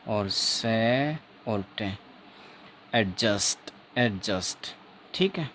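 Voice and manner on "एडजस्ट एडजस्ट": 'एडजस्ट' modelled with the d silent. Shown as a demonstration. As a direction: say 'एडजस्ट' as a model, j carrying the middle. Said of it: "एक बार मैं हिंदी में".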